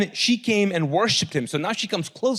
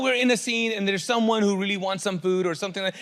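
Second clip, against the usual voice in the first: normal talking voice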